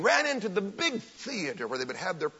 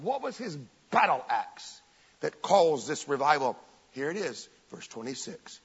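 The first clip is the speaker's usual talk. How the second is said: ordinary talk, nothing marked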